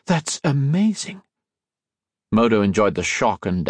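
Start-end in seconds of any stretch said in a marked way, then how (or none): 0.1-1.2 high, slow and awestruck; amazed wonder. A narrator's reading. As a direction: marvel at it, drawing the words out. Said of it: "stared back in wonder".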